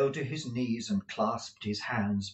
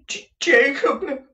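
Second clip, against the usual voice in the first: a high, loud, stammered plea; imploring; 9 dB louder than usual. Acted. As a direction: beg the ghost by name. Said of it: "he said, imploringly".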